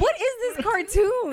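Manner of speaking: incredulous disbelief. Real, not acted. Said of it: "incredulous reaction".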